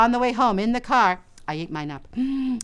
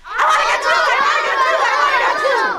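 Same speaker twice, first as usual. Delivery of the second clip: put-on high, loud whiny child's wail; a three-year-old throwing a tantrum again; shrill toddler tantrum; screaming toddler; 7 dB louder, faster than usual. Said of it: "and I started screaming".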